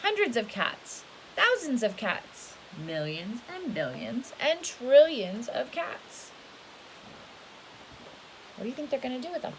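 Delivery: lively storybook read, rising and falling; storybook voice for a little one; reading to children. A narrator's reading. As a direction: read it big and animated for a child.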